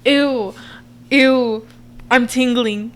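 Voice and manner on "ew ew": grossed-out ew; disgusted. Real, not acted. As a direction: recoil in disgust. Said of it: "That made me disgusted".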